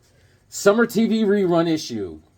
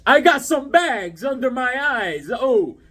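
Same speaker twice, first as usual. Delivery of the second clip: higher, slower, whiny complaint; mock tired whine; 7 semitones higher, 3 dB louder, slower than usual. Shown as a demonstration. Tired collector moaning to viewers, slow and high. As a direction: drag it out like you're exhausted. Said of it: "show you how tired I've been".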